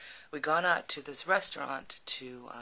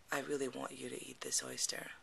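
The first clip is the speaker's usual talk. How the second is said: close, low request; 7 dB quieter than usual. Retold or imitated. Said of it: "leans in really close and goes".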